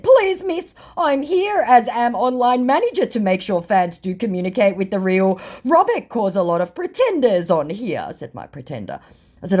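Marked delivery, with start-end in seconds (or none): 0.0-8.1 high, pleading fake manager; mocking the fake manager's plea, higher; mock scammer voice. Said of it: "To which my scammer said".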